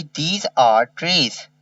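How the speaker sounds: each word stretched for copying; very slow dictation. Shown as a demonstration. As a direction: dictate it slowly. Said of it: "slow dictation read".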